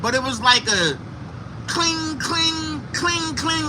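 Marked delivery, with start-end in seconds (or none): 1.7-3.7 high, softer ringing clings; imitated metallic clinking. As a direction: ring it out like a pipe hitting glass. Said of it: "like a pipe".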